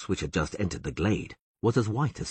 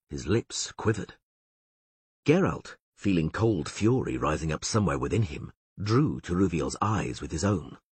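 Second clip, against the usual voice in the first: usual speaking voice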